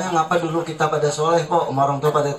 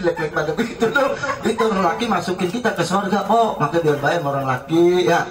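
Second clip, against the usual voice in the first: normal talking voice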